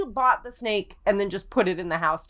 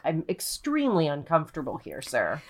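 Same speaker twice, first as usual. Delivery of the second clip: voicing the snake; 6 semitones lower than usual. The snake complaining to its owner, low and uneasy. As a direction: lower the voice into a stiff, uneasy snake. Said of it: "a snake that's like".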